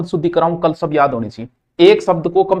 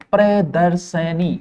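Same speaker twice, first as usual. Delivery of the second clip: प्रदर्शनी drawn out slowly; slow teacher's demo of प्रदर्शनी; slow, syllable by syllable; 3 dB quieter, much slower than usual. Shown as a demonstration. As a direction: spell it out in sound. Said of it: "प्रदर्शिनी नहीं होता".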